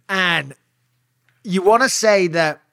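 ordinary talk, nothing marked

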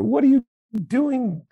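high, loud panicky Muppet voice; hammed-up high Grover yelling at the reader; frantic Grover. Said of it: "terrified Grover".

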